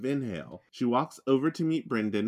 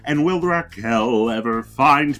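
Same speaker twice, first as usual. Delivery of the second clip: loud, building announcer voice; dramatic announcer outro; 10 dB louder than usual. Acted. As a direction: tease the next episode big.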